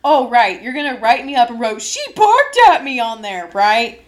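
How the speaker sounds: loud, mocking sarcasm; sarcastic. Sarcastic retort to coach.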